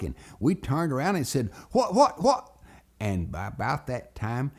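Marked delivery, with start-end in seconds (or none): 1.7-2.6 high, loud, bewildered yelps; startled. Retold or imitated. Two boys shoved into the dark well house, startled. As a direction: blurt it in alarm, high and sharp.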